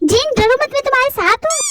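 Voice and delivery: put-on high girlish comforting voice; young woman calming her friend; reassuring girl. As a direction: soothe a scared friend.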